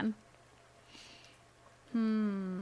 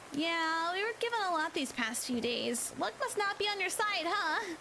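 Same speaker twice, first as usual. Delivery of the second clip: higher, bright schoolgirl voice; cheerful girl voice; 7 semitones higher than usual. A narrator's reading. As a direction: play the chipper classmate teasing. Narrator voices Kikamura.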